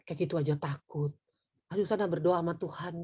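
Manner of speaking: low, soft brush-off; dismissive parent. Acted. Parent brushing off a frightened child, dismissive. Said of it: "Saya tidak pernah bilang gini".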